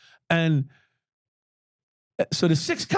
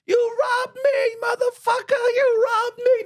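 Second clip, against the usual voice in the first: much higher, slow, imitated shriek of the woman; screaming woman; 4 dB louder, slower than usual. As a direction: mimic her wailing accusation, high and drawn out. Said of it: "she starts screaming out".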